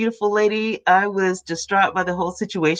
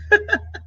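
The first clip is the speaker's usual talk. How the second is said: short high laugh. Real, not acted. Laughs at her metal straw.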